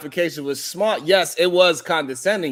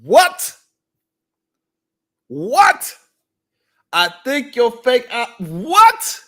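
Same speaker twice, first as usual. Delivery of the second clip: stunned what; 3 semitones higher, 4 dB louder, much slower than usual. Real, not acted. Vlogger hearing the eyelash insult, loud shocked disbelief. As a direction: react in shock to the eyelash jab.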